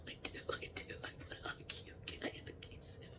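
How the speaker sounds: soft unvoiced whisper. Real, not acted. Medium asking a spirit for a hug, whispering. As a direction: breathe it tenderly.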